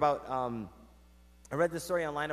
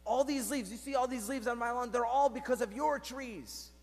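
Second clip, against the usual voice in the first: mock yelling neighbour; 7 semitones higher than usual. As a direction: complain in a raised, stretched mock yell. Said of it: "he starts yelling and he's like".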